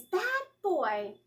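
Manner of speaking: high scolding of a bad dog; firm scolding. A narrator's reading. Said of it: "in a very firm voice".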